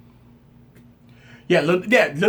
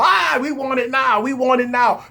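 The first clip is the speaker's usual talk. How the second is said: mock whiny chant, higher; impatient crowd; 6 semitones higher than usual. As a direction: play the fans demanding it. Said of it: "everybody gonna be in the uproar".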